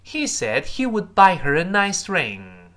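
drawn-out full-sentence demonstration; slow model reading. Shown as a demonstration. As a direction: read it out slowly for learners to repeat. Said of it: "我们再完整读一下这句话".

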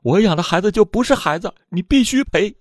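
mother's frantic demand, pitched high and rising; hysterical, furious outcry. A narrator's reading. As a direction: voice climbing in desperate fury. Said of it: "歇斯底里地朝着袁桂玲怒吼道".

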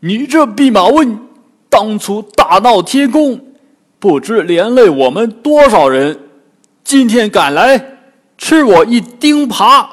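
furious pig demon cursing the Monkey King; angry cursing. Acted. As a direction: bellow the insults, swinging the rake. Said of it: "骂道".

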